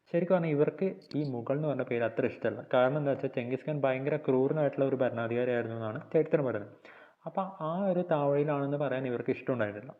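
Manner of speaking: ordinary talk, nothing marked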